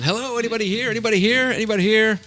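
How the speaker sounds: high, sing-out call into an empty house. Retold or imitated. Agent calling into a house he walked into, retold yell. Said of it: "I'm, I'm yelling".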